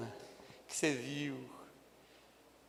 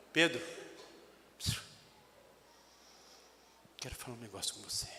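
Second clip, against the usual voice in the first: low, soft, fast; ominous quiet; 9 semitones lower, 14 dB quieter, faster than usual. Acted. Jesus summoning Peter, hushed and ominous. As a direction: say it hushed and foreboding. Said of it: "me coloquei no lugar de Jesus".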